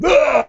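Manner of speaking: sudden high yelp of fright, mock; a sharp jump-back yelp; startled yelp. Producer jumping back from camera, startled yelp. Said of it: "he exclaimed a loud noise".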